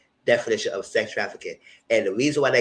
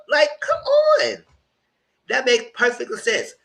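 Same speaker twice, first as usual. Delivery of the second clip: exasperated; 12 semitones higher, 4 dB louder, slower than usual. Real, not acted. Exasperated at jurors' logic.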